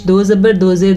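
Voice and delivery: normal talking voice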